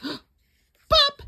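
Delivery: sharp, very high, loud pop; popping sound. A narrator's reading. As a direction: make the button burst off. Button pops off shirt.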